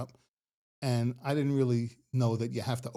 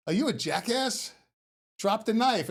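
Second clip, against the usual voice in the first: high, loud mock-stern officer; customs agent barking; 9 semitones higher, 4 dB louder, slower than usual. Customs agent scolding the driver, loud mock stern. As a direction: bark it like a fed-up border agent. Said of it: "So she goes".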